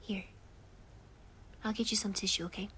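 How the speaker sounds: hushed, tender soothing to a friend in tears; calm them down gently, mostly whispered; comforting whisper. Acted.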